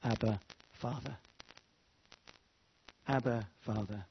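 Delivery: hushed, tender call to a father; intimate cry. Shown as a demonstration. Believer softly calling God as Father, hushed and tender. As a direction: breathe it softly, like a child reaching for dad. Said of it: "we cry".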